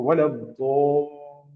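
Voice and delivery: वलद्दोल्लीन stretched very slowly; slow drawn-out वलद्दोल्लीन; long madd recitation. Shown as a demonstration. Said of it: "अब यहाँ लीन पे खी".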